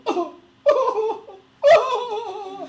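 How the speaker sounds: very high, loud fake sobbing howl; mock crying wail. Sister turns on the tears for dad, exaggerated high wail. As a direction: turn on hammed-up waterworks. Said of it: "they turn the faucets and they go".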